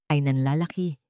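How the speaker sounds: ordinary delivery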